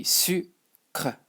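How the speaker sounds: "sucre" sounded out slowly as su, then kre; no final E. Shown as a demonstration. Teacher explaining the silent E to learners, slow split pronunciation. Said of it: "imagine it was just written like this".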